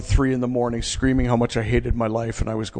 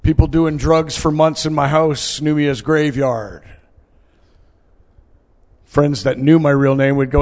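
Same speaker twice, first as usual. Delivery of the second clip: ordinary talk, nothing marked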